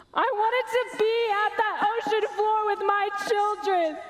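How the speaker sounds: high, tearful voice breaking into sobs; weeping. Acted. Mother mourning her children lost at sea, weeping. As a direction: sob through the words, wanting to be with them.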